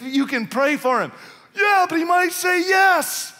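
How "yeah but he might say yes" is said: high, slow, panicky "but he might say yes"; nervous objector. Pretend. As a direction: play the scared churchgoer making excuses.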